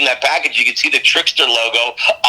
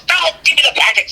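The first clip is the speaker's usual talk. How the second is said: mock star brush-off. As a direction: toss it off lightly and high. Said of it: "He goes".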